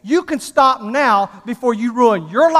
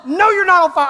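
exasperated contradiction; 6 semitones higher than usual. Retold or imitated. Wife argues back, acted out.

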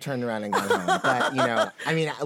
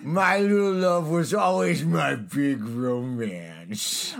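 slow, drawn-out showbiz-diva caricature; Carol Channing; 4 dB louder, slower than usual. Shown as a demonstration. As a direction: commit fully to the stage-diva character. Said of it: "let me do it as Carol Channing".

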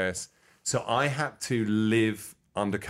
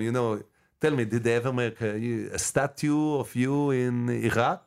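a hint of a foreign interviewer; interviewer character voice; 2 semitones higher than usual. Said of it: "one boy, I tell him".

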